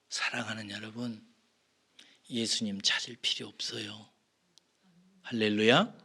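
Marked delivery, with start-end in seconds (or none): none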